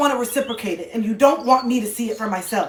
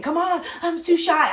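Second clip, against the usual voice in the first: mock shy guest; 6 semitones higher than usual. Others saying they're too shy.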